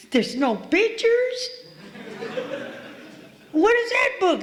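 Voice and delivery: mock dismay. Reacting to the Big Book assignment in high mock disbelief. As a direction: play up the shock, way up high. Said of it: "And I'm like".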